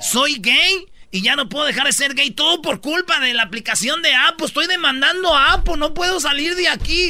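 high, building comic rant; hammed-up helpless wail; mock desperate complaint. Acts the man's frantic complaint.

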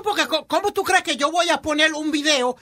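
ordinary talk

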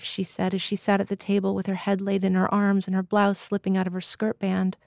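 ordinary delivery, nothing marked

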